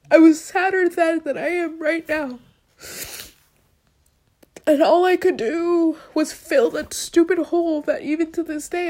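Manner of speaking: high, tearful crying voice; sobbing through the words. Real, not acted. Crying to the camera about feeling sad.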